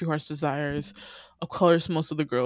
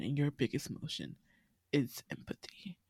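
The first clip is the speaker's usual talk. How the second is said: much softer, ending in a whisper; soft hush; 2 semitones lower, 11 dB quieter than usual. Acted. Teacher telling the upset student gently, whispered. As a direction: let it fall to a whisper.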